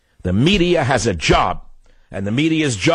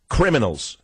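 ordinary talk, nothing marked

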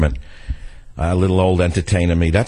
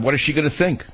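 ordinary delivery

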